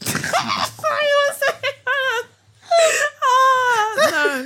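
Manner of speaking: very high, helpless laughing squeal. Real, not acted. Host tempted to say something rude on air, squealing with laughter. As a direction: squeal it through real laughter.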